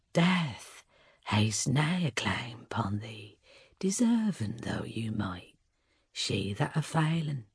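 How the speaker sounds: low slow whispered spirit; echoing ethereal energy being. A narrator's reading. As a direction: ancient eerie forest spirit. Sylvaneth spirit speaks.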